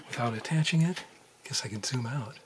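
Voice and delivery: ordinary talk, nothing marked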